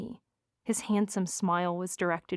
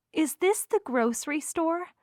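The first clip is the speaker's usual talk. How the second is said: put-on higher, sweet girlfriend voice; mature, sweet woman; 8 semitones higher than usual. A narrator's reading. Girlfriend asking about the store, mature and sweet. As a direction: voice a sweet, grown-up girlfriend, pitched up. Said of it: "she sounded mature and sweet".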